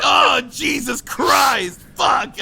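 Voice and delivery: loud drawn-out angry yell; furious shout. Retold or imitated. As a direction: scream the curse out in frustration. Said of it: "Like, I was so mad".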